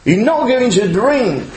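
usual speaking voice

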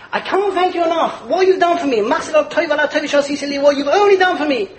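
high, effusive overflowing gratitude; gushing thanks. Retold or imitated. Man gushing thanks to a dignitary, high and effusive. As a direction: pour out thanks far too much.

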